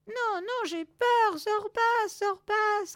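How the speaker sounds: high, loud frightened pleading; panicked cry. Acted. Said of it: "cria-t-elle". (cri paniqué, très aigu)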